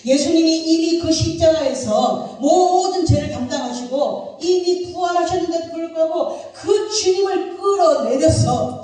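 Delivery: high, loud, impassioned preaching; full-force preaching. Acted. (목소리 높여 힘차게 설교)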